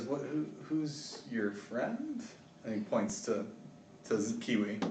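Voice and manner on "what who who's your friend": 1 hesitant guard. Acted. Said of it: "he looks at you and he goes".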